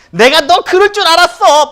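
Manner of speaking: high, loud I-knew-it outburst; angry glare. Retold or imitated. Imagined Jesus glaring at Peter, loud accusation. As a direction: snap it with glaring eyes. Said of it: "막 눈을 부라리면서".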